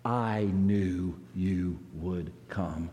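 a dying soldier's faint relief; weak wounded voice. Acted. Wounded friend on battlefield speaks.

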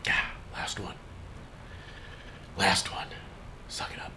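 whispered pep talk under the breath; whispering to self. Real, not acted. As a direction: psych yourself up under your breath. Whispered aside before last claim.

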